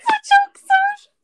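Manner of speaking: high, slow, laughing through words; laughing hard. Real, not acted. Laughing while telling story.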